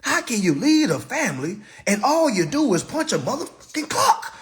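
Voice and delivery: ordinary talk, nothing marked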